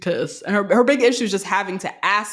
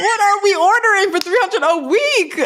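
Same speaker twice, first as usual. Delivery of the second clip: laughing shout; 12 semitones higher, slower than usual. Real, not acted. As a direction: yell it while cracking up. Laughing outrage at grocery bill.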